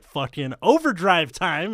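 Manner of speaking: put-on loud, slow pumped-up kid; hyped kid. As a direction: growl it, revving up. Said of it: "and he's like".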